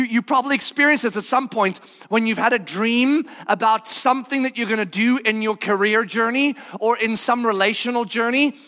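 ordinary talk

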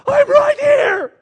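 drawn-out, high-pitched full shout; exasperated shout. Acted. Preacher as Jesus crying out to the Pharisees, exasperated shout. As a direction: bellow it slowly to be noticed.

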